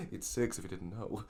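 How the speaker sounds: slow, pedantic teacher voice; hammed-up math teacher telling a proud anecdote; sixth grade math teacher. Playing along as the math teacher co-host described, hammed-up. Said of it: "I'm a sixth grade math teacher".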